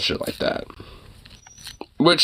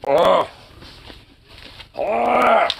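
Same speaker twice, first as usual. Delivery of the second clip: frustrated groan, climbing higher; exasperated groan; 7 semitones higher than usual. Real, not acted. Owner fed up with his dog, groaning.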